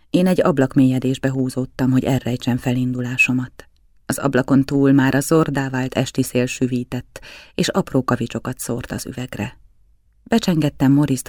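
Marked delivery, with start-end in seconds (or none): none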